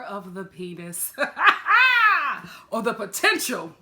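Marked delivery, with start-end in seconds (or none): none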